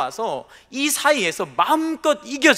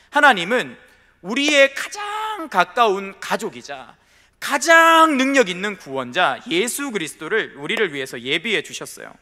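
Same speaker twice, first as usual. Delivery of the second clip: ordinary talk, nothing marked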